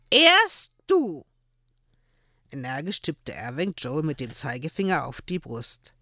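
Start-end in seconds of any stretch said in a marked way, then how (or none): 0.1-1.2 put-on high, loud insistent little boy; child refusing to budge; stubborn child. A narrator's reading. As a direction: demand it like a pouting child.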